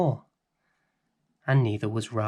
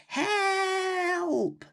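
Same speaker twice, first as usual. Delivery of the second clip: put-on high, desperate little-girl cry; cry for help. A narrator's reading. Rose blown about by the storm, crying out for help. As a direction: shout for help as the wind takes Matthew. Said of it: "Rose cried".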